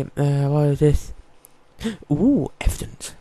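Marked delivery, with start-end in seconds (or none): 1.8-3.1 excited. Real, not acted. Finds evidence.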